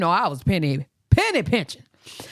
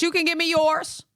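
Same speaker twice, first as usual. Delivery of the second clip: higher, smug retort; cheeky comeback; 11 semitones higher than usual. Retold or imitated. Son firing back at her request, smug and higher. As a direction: turn it back on her, smugly.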